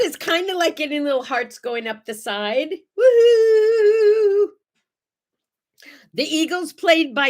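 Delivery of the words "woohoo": loud high woohoo; excited cheer. Real, not acted. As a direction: whoop with joy. Birthday excitement.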